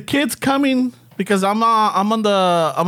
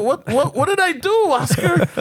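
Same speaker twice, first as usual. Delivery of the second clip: rising indignant protest; 8 semitones higher than usual. Retold or imitated. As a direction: voice climbing higher in mock outrage.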